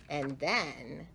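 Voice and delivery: ordinary delivery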